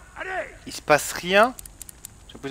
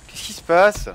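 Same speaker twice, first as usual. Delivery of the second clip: confused; 6 semitones higher, 6 dB louder, slower than usual. Real, not acted. Game bug, confused streamer.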